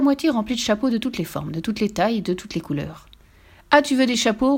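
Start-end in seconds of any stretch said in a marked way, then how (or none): 3.7-4.6 raised, higher angry challenge; father roaring. A narrator's reading. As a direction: roar it mildly at the child. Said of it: "rugit le père". (père qui rugit)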